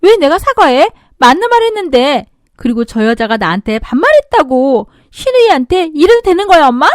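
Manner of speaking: loud, whiny angry protest; tearful anger. Acted. Sister-in-law protesting to her mother, tearful anger. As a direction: yell at mom, near tears. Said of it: "울먹거리면서 화를 냈죠".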